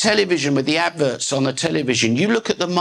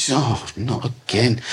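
slow, lower mock-weary groan of words; mock weary complaint; 5 semitones lower than usual. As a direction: sigh it like a fed-up complainer. Acted weary complaint to God.

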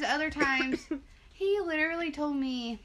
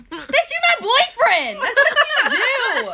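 indignant outburst; 13 semitones higher, 14 dB louder than usual. Retold or imitated. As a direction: holler it in outraged disbelief. Acted outburst at her boyfriend.

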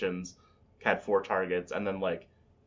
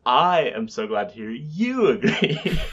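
mocking echo; 4 semitones higher, 6 dB louder, slower than usual. Teasing echo of cohost's line.

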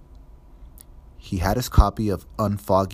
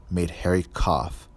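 plain reading voice

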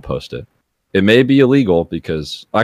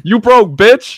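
much higher, louder mock taunt; mock yell; 13 semitones higher, 6 dB louder, slower than usual. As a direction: belt it out like a playground jeer. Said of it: "And then you go".

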